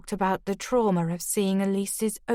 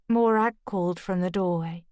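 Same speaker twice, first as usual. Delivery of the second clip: ordinary delivery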